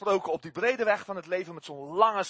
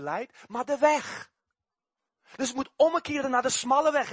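raised, forceful pulpit call; preaching at full force; 7 semitones higher, 5 dB louder than usual. Acted. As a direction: hammer home the need to turn around. (krachtig preken met luide stem)

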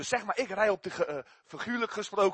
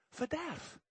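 usual speaking voice

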